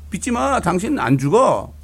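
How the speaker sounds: high, firm reassurance; insistent declaring. Retold or imitated. Wife declaring to her husband he won't die, firm and loud. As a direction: declare it over him.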